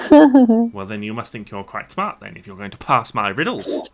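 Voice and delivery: high, loud goblin sneer; goblin taunt. Acted.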